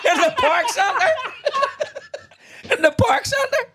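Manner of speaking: high-pitched laughing repeat. Real, not acted. Telling his pal about the photos, laughing helplessly. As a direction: squeal it out through laughter. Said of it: "repeated for laughs".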